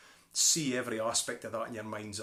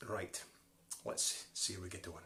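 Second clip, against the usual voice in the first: usual speaking voice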